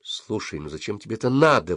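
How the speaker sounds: ordinary delivery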